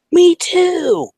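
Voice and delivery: high, loud squeal; thrilled agreement. Retold or imitated. Retold girls discovering a shared concert, thrilled squeal. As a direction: imitate girls shrieking in delight.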